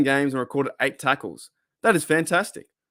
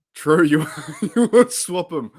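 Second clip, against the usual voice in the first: laughing; 6 semitones higher, 4 dB louder than usual. Real, not acted. Laughing while reacting to a chat comment.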